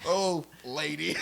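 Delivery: slow mock hero voice. Quoting Superman, slow drawn.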